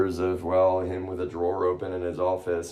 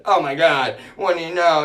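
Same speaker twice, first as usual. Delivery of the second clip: high, loud sarcastic mock outrage; hammed-up indignant yelp; mock outraged relatives; 10 semitones higher, 12 dB louder than usual. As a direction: shriek back as the offended family.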